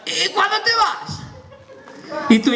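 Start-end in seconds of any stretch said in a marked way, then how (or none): 0.0-1.2 vecino preguntón. Acted. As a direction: call out high like a nosy neighbour. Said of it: "te mira". (vecino llamando con voz aguda y alargada)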